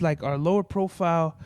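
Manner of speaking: usual speaking voice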